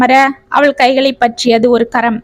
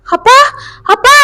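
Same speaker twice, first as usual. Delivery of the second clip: shouting; 10 semitones higher than usual. A narrator's reading. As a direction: cry out to him in panic. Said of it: "என்று கத்திக்கொண்டே".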